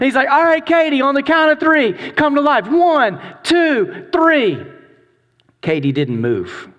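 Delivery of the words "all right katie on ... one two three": countdown call. Retold or imitated. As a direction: build suspense like a showman counting down. Teacher counting down for reveal.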